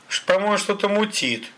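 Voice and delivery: high-pitched suspicious remark; suspicious thug. A narrator's reading. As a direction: voice a doubtful thug, pitched well up. Thug voices suspicion of captive.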